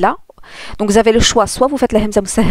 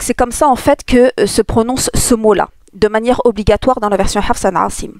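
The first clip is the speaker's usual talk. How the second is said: ordinary talk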